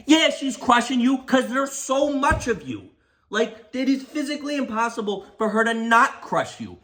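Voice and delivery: high-pitched, incredulous comeback; exasperated retort. Acted. Storyteller answering the passenger's complaint about being crushed, exasperated retort.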